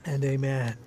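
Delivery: low, soft amens, fading away; hushed praise. Real, not acted. Pastor closing the prayer to the congregation, hushed praise.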